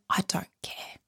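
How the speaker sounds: very soft, voiceless whisper; whispered aside. Real, not acted. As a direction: drop right down to a whisper for emphasis. Blunt aside on being loathed.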